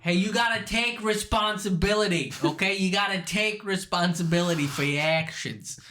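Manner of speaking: high, preachy Boston scolding; mock Boston accent. Bostonian lecturing about responsibility, mock Boston accent.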